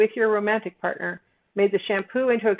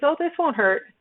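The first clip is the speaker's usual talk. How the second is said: reassuring nurse; 6 semitones higher than usual. Retold or imitated. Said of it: "somebody says".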